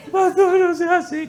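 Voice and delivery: very high, slow whine with a laugh; whining sick man. Retold or imitated. Husband begs the pastor in hospital, high comic whine. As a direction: beg the pastor to stop, whimpering comically.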